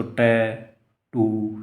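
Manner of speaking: Tattoo broken slowly into two syllables; split into syllables. Shown as a demonstration. Teacher showing a student what syllables are, tattoo slowly split in two. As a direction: say tattoo as टै, pause, टू. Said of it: "ये दोनों syllable हैं इसके".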